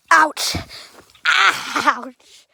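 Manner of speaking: loud, sudden yelps of pain; real pain. Real, not acted. Explorer reacting to something whacking them, pained yelp. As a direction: cry out at being hit.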